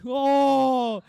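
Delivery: loud, drawn-out "oh" of shock; mock outcry. Dad reacting to pregnancy news, loud outcry. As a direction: wail it out as the stunned dad.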